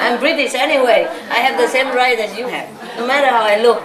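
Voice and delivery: normal talking voice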